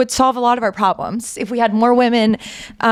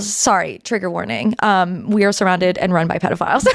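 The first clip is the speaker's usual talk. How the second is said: ordinary talk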